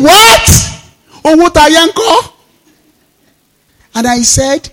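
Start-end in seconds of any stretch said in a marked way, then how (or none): 0.0-2.3 shocked disbelief. Retold or imitated. Sister hearing he dropped the business, shrieking surprise. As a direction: squeal the question in disbelief.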